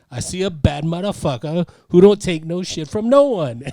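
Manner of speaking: pep talk. Retold or imitated. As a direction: hype him up, retelling with gusto. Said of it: "gives him the greatest pep talk ever".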